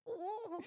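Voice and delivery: high-pitched mock pain whimper; re-enacted whimper of pain; imitated whimper. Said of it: "I was whimpering".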